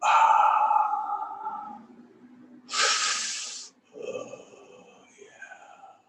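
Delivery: contented bath sighs. Acted. King relaxing in warm bath.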